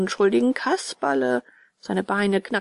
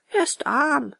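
high little answer; puppet boy; 6 semitones higher than usual. A narrator's reading. Bengele answers about his father. (hohe Puppenjungenstimme)

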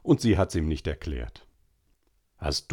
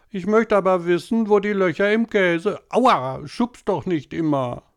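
put-on high, whining little boy voice; whiny boy; 11 semitones higher, 5 dB louder than usual. A narrator's reading. Tobi whining to mama at dinner, high and whiny. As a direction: whine like a small boy pestering mama.

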